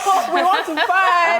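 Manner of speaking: mock it with a laugh; sing-song laughing dare. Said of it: "It's like you're saying".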